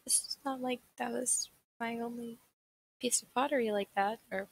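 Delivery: high sing-song it's fine to a friend; imitating a kind friend, lifted high; soothing reassurance. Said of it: "you're just like".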